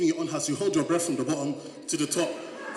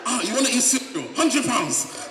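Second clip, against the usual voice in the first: mocking greedy parents; 8 semitones higher, 5 dB louder than usual. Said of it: "they will now be changing on you".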